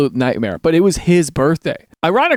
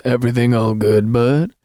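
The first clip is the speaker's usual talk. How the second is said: ominous; 5 semitones lower than usual. Retold or imitated. Reader re-enacting the revenge line, ominous. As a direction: imitate a lightly threatening check-in. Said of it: "that's pretty ominous".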